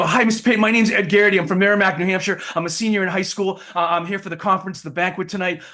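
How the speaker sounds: high, excited self-introduction; eager fan. Retold or imitated. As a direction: blurt it out like a starstruck teenager. Retold eager teen introducing himself.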